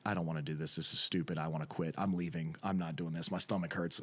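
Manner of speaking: low grumbling inner monologue; understated reluctant self-talk; pre-match dread. Retold or imitated. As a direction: recite your doubts in a low, sinking voice. Voicing his pre-match dread.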